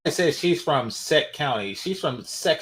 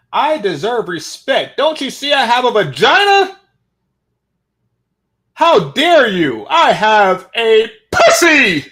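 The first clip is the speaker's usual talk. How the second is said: slow, hammed-up outrage building to a shout; mock indignation; 6 semitones higher, 10 dB louder, slower than usual. Reactor parodying her demand that women deserve respect, hammed-up indignant shouting.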